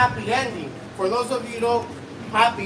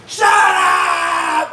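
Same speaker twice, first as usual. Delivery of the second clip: sudden loud, drawn-out yell; furious mock scream; 5 dB louder than usual. Nurse to the groaning patient, screamed order. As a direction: explode as the fed-up nurse.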